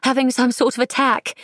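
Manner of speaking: put-on fretful society girl, clipped upper-class English; clipped upper-class English accent. A narrator's reading. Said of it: "the girl's clipped accent".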